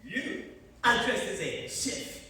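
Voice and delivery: hammed-up French chef accent; fake French accent. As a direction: lay the French accent on thick, like a cartoon chef. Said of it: "Why are you speaking in a German accent?".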